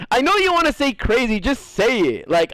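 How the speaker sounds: usual speaking voice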